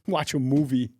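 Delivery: halting impersonated star deadpan; Christopher Walken. Retold or imitated. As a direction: break the phrase as the impersonated actor. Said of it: "you're gonna do Christopher Walken".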